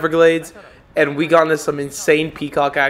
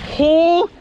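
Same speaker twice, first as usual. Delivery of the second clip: excited shout; 12 semitones higher, 6 dB louder than usual. Real, not acted. Fish near the net.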